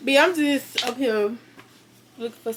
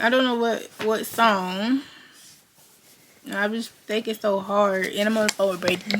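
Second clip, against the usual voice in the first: ordinary talk, nothing marked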